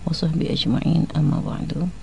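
loud, formal Arabic opening to the show; radio opening prayer. Acted. Radio host opening the show for listeners, loud and formal. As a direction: announce the opening blessings to the listeners.

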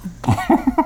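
short amused laugh; a quick light laugh. Real, not acted. Laughs at partner's remark.

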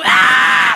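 loud strained karate yell; hammed-up straining kiai; straining grunt. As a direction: push like straining hard. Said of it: "ekspresi ngeden ekstrim".

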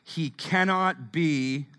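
slow, deliberate call-and-response reading; slow read-aloud. Shown as a demonstration. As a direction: spell it out slowly for the crowd to follow. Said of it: "read that last line ... out loud".

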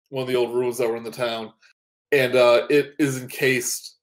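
usual speaking voice

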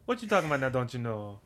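a laughing, hammed-up New Orleans accent in a [name] voice. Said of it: "would you take a spin as [name]".

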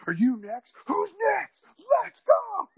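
very high, loud taunting shout; fight challenge yell. Retold or imitated. Challenging the guys around him after the takedown, yelling. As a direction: dare the crowd to step up.

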